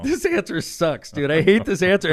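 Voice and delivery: slow, high, disgusted grumble; retold exasperation; frustrated complaint. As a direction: hate every word of it. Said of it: "I'm like".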